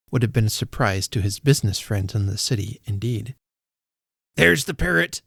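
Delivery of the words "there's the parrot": high, loud cry of delight; excited cry. A narrator's reading. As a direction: cry out as you spot it. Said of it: "cried Scrooge".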